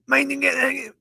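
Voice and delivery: very high fussing mum. Retold or imitated. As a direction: chirp it like an anxious mum. Voicing mum.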